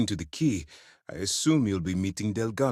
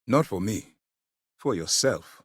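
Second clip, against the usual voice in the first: normal talking voice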